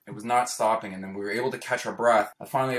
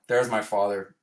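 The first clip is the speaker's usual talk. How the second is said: normal talking voice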